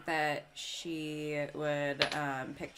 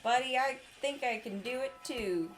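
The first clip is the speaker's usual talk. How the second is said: high-pitched, put-on grateful reply; chirpy in-character thanks; 8 semitones higher than usual. Player answering a friend's bardic inspiration, high and chirpy. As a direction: pitch it way up, settling at the end.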